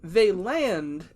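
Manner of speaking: normal talking voice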